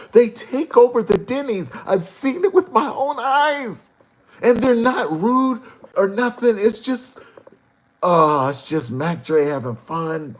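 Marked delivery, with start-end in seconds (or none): 0.1-7.2 much higher, louder hype; excited retelling. Retold or imitated. Telling fans about [name], excited and loud.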